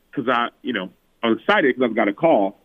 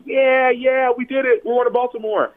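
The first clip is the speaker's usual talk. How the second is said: high, excited cheering; mock family cheer, pitched way up; family freaking out; 13 semitones higher than usual. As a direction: imitate the family celebrating too early. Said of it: "my family starts freaking out".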